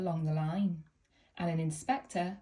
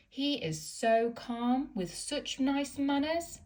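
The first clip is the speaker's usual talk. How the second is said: slow admiring coaches; 7 semitones higher, slower than usual. A narrator's reading. Coaches praising Duck.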